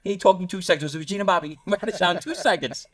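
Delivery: high-pitched incredulous amusement; real laugh breaking into the story; amused, laughing. Real, not acted. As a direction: tell it through a laugh, voice climbing. Amused telling of being given up.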